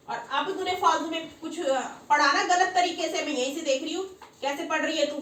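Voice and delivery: loud, high, irritated telling-off; scolding. Real, not acted. Teacher scolding the girl for reading wrongly, annoyed and loud.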